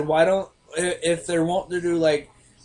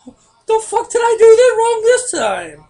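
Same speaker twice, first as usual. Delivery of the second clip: high, loud squeaky indignant mock voice; mock cashier; 8 dB louder than usual. Cashier sarcastically voicing her own thoughts at the customer. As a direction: play the offended cashier to the hilt. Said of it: "And it's like".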